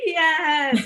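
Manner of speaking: high, loud whoop of yes; excited cheer. Retold or imitated. Audience answering the pre-show announcement, excited high cheer.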